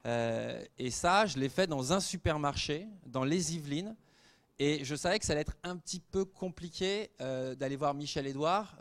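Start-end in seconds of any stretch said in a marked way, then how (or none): none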